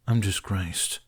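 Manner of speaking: flat, defeated monotone. A narrator's reading. Toothless admitting his shame, in a monotone. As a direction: say it without any life. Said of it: "Toothless rephrased, monotone".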